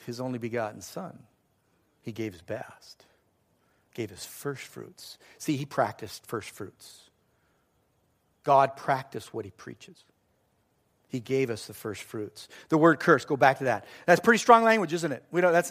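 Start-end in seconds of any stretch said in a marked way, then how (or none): none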